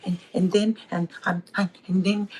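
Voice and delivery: a light imitation of a stuttering child; imitating stuttering kid. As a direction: act out the stammering boy.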